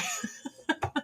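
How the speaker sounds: a short, high, soft laugh at her own joke; high breathy laugh. Real, not acted. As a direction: laugh it off softly.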